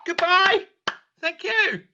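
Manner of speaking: high sing-song. Acted. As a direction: chirp a high farewell. Cheery high sign-off.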